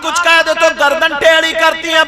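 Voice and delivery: loud, pleading sermon voice; preaching at full force. Acted. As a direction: call out to the sisters. Preacher addresses girls in crowd.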